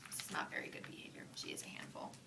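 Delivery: soft whispered aside to the listener. Real, not acted. Reader whispering a comment to the listening child. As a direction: lean in and whisper it. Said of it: "reader's whispered aside".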